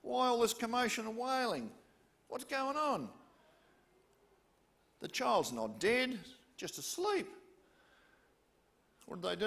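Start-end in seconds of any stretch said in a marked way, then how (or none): 0.0-7.3 higher, louder, puzzled challenge; incredulous question. Acted. Jesus to the wailing mourners, incredulous. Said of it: "He went into them and said".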